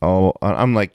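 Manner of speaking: ordinary talk, nothing marked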